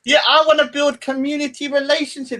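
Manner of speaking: high, mocking imitation of her rant; high mock voice of the woman he's ridiculing. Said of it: "Like, she's the extremist".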